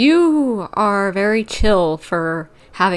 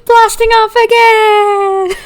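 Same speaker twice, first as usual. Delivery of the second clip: mock villain send-off; 14 semitones higher, 9 dB louder than usual. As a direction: wail it loudly like a defeated villain flying away. Mock cry as villain is thrown away.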